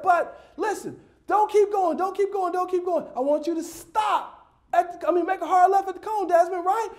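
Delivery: high-pitched, strained-patience instruction; coaxing coach. Retold or imitated. Coach pleads with the running child.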